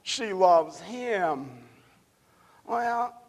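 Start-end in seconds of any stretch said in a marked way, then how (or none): 0.0-1.7 higher, louder, fretful chatter that slows right down at the end; mock tut-tutting wedding guests, slowing at the end; gossiping church women. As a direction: ham up the worried ladies in the pews. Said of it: "And the women start saying".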